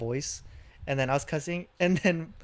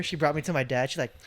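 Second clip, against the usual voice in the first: usual speaking voice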